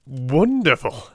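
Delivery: drawn-out amazed Wonderful; astonished exclamation. Acted. Watson marvels at Holmes's deduction, amazed. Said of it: "I ejaculated".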